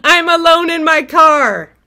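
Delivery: wailing alone in the car that God left her; despairing wail. Retold or imitated. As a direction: cry out to God in hammed-up despair. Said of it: "while I'm going".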